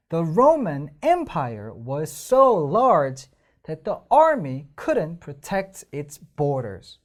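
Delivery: very slow, so and army punched; The Roman Empire was so large that the army couldn't protect its borders with stresses beaten out; stressed reading. Shown as a demonstration. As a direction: read it through, beating each stress. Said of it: "강세 들어간 부분에 손".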